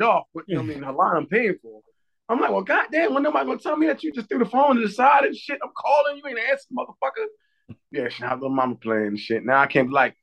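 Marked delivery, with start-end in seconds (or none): 2.5-7.7 high-pitched exasperated dad rant; retold angry rant. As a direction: rant at the kid in mock outrage. Retelling an angry call to daughter.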